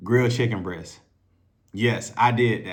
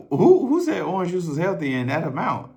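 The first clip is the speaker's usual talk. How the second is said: incredulous; 7 semitones higher, 4 dB louder than usual. Retold or imitated. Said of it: "I like".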